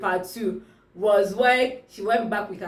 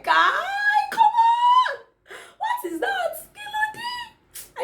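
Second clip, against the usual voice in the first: very high squealed protest; falsetto, drawn-out exasperation; exasperated outcry; slower than usual. Acted. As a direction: squeal it out in mock disbelief. Exasperated reaction to the plot.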